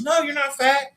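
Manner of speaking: high, sing-song no you're not fat; squeaky mock reassurance; high reassuring chorus. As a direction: everyone chiming in high. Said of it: "everyone in the room goes".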